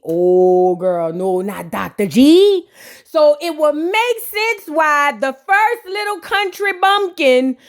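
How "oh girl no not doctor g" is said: lower, drawled rapper imitation; lower, drawled rapper impression of the reply. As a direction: put on a low rapper voice for the reply. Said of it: "In my [name] voice".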